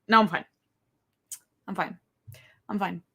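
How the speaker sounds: ordinary delivery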